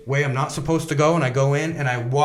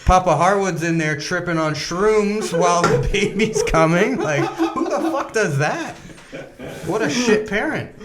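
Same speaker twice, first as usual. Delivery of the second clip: higher and slower, chuckling through the words; laughing at himself; 4 semitones higher, slower than usual. Real, not acted. Mocking himself to friends, laughing. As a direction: laugh at your own bad parenting. Laughing at his own story.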